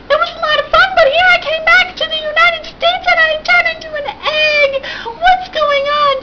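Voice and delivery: high, whiny mock sob; mock wailing. Sister complains to her brother Jeff, high mock wail. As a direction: wail it like a hammed-up crybaby. Sister despairs over being egg.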